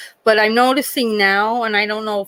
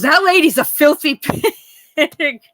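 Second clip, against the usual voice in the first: higher, louder put-on old lady voice, laughing mid-line; old patient's insult; 6 semitones higher, 4 dB louder than usual. Patient sneering at her roommate, in a higher, louder, laughing old-lady voice. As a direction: voice the cranky patient, half laughing.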